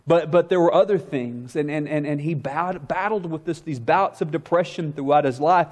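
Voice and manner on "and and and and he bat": ordinary delivery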